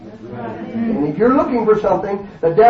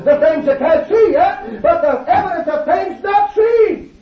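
preaching at full force; 5 semitones higher than usual. Acted. Preacher rousing his congregation, in full-force preaching. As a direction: shout the faith like a tent-meeting preacher.

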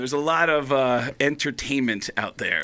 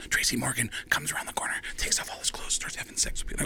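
hushed, scandalized whisper; super nervous; 9 dB quieter than usual. Retold or imitated. Agent confiding party gossip to comic, nervous whisper. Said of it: "super nervous".

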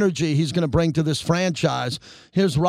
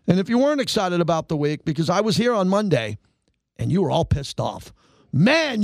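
ordinary talk, nothing marked

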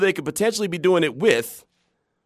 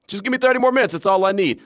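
eager plea; 6 semitones higher than usual. Retold or imitated. To his wife after the show ended, eager begging. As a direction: beg for just a bit more.

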